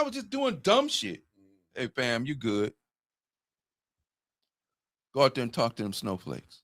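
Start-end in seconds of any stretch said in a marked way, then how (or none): 1.7-6.6 a low, quiet, deadpan dad voice; dad's calm order. Retold or imitated. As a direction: hand out the punishment quietly and calmly. Dad gives a mock punishment.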